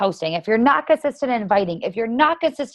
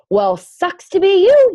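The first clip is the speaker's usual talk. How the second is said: loud, high, slow mocking taunt; sarcastic loud put-down; harsh jab; 10 semitones higher, 7 dB louder, slower than usual. Real, not acted. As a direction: rub it in, loudly. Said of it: "harsh but true".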